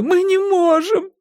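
high, drawn-out tearful protest; anguished slow whimper. A narrator's reading. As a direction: plead while whining. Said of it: "хныкала Силья".

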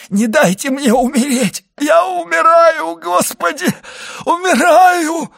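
high, drawn-out terrified wail; screaming. A narrator's reading. Dying servant begs not to die, screaming in terror. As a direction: scream for your life, stretching the words. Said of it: "кричал Барруа".

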